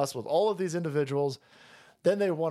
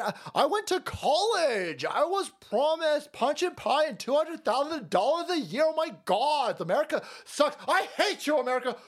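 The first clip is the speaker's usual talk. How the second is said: mocking, much higher whiny girl voice; whiny college grad; 9 semitones higher than usual. Mocking the woman from the clip, high whiny parody. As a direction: sneer it like a spoiled grad crying for her big job. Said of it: "And she sits there and go".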